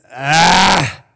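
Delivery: loud wordless guttural "ah"; guttural exclamation. Shown as a demonstration. Preacher showing the demon's wordless cry, guttural exclamation. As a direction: let out an emotional, throaty groan of protest. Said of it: "this guttural, emotional exclamation".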